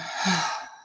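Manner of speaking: long out-breath sigh through the mouth; audible sigh. Shown as a demonstration. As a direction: let the breath go in a relaxed sigh. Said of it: "making it like a sigh".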